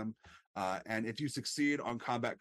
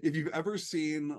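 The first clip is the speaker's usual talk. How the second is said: usual speaking voice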